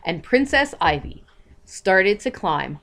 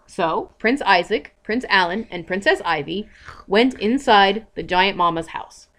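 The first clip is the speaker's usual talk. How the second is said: usual speaking voice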